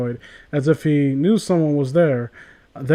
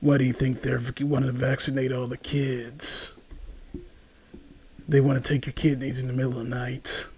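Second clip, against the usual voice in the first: low, much softer conspiracy voice; hushed low conspiratorial crank; conspiracy theorist; 6 semitones lower, 14 dB quieter than usual. Retold or imitated. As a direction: murmur it low like a paranoid crank. Mock conspiracy theorist quote.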